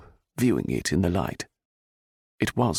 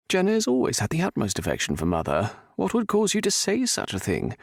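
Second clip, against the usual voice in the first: lightly voiced indignant son; 10 semitones higher than usual. A narrator's reading. Stuart defending Jenna.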